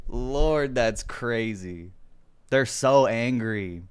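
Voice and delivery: usual speaking voice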